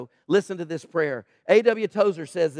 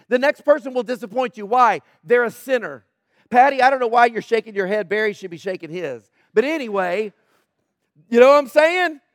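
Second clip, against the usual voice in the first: full-force sermon, louder; preaching; 4 semitones higher, 6 dB louder than usual. Real, not acted. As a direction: preach it out to the room, pressing each point. Preaching loudly to congregation.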